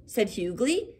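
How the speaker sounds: usual speaking voice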